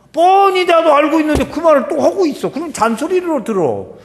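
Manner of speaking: loud, high-pitched protest at being nagged; exasperated complaint. Acted. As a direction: complain loudly like a nagged child. Voices a nagged child's complaint. (잔소리에 질린 듯 높고 큰 소리로)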